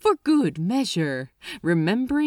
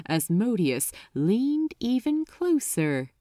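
ordinary talk

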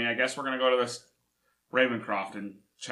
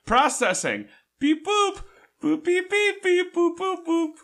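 high squeaky beeps and boops; robot computer noises; 4 dB louder, slower than usual. Pretend. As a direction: play a beeping computer, pitched way up. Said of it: "Computer noises".

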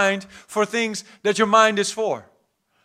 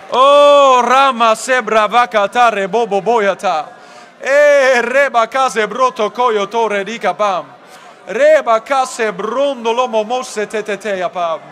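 praying in tongues; 10 dB louder than usual. Real, not acted. Preacher leading the church in the Holy Ghost, fervent tongues. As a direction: pour out syllables in a loud, rolling chant.

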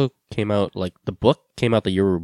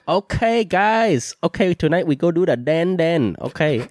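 put-on higher, upbeat Vietnamese-accented guide; 4 semitones higher than usual. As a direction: announce the night out with a Vietnamese accent. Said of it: "what happened to your accent?".

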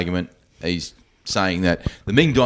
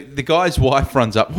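normal talking voice

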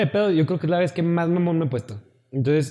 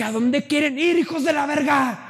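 angry shout; 9 semitones higher than usual. Retold or imitated. Repeating the question to his silent friends, yelling. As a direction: bellow it at them like an enraged dad. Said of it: "va a ser un papá bien culero".

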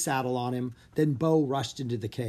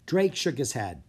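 plain reading voice

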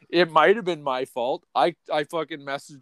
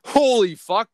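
high, loud disbelief; loud stunned outburst; astonished exclamation; 9 semitones higher, 6 dB louder, slower than usual. Real, not acted. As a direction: burst out in amazement. Reacts to the message.

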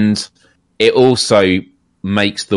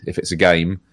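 ordinary talk, nothing marked

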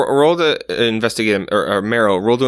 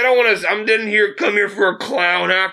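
grouse at the juggler like a fed-up shopper; grumpy customer; 9 semitones higher than usual. Acted. Customer snapping at a juggling busker, high put-on voice.

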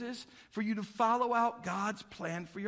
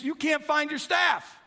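normal talking voice

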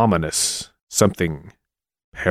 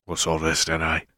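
mock cockney bobby; 4 semitones lower, 7 dB quieter than usual.

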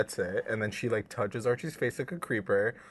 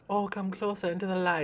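put-on higher creepy old-woman voice; creepy old lady; 8 semitones higher than usual. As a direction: beckon like a creepy granny.

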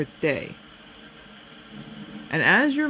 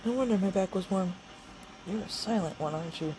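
higher, softer tender voice to a pet; soft cat talk; 4 semitones higher, 13 dB quieter, faster than usual. Real, not acted. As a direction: coo gently to the cat. Talking softly to her cat.

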